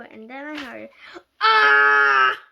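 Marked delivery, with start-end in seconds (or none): 1.4-2.4 loud shrill scream. A narrator's reading. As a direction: let out a piercing scream. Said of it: "My mom screamed".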